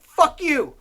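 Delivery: high, punchy mock retort; defiant retort. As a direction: spit it back defiantly. Retorting to Obama.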